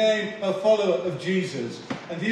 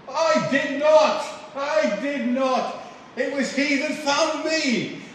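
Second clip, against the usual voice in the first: put-on high, slow emphatic denial in own accent; raised, measured insistence on "I did not"; emphatic interpretation; 7 semitones higher, slower than usual. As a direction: hammer out "I did not" word by word. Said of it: "So to be interpreted, it means".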